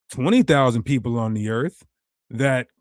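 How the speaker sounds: ordinary talk, nothing marked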